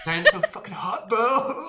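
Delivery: loud high gushing cry; loud swooning fan voice. Pretend. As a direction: swoon over her like a superfan. Fans gushing over singer.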